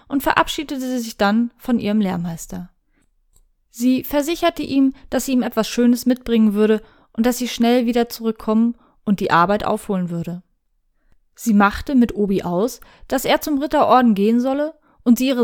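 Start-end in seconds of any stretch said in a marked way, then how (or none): none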